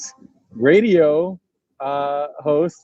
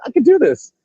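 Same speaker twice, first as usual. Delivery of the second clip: imagined excitement; 10 semitones higher, 4 dB louder than usual. Retold or imitated. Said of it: "made you like".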